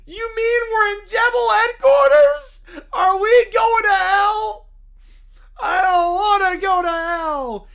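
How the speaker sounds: loud, slow crying shout; hammed-up sobbing panic; terrified wailing. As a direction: wail it like a scared child. Kids fear going to hell.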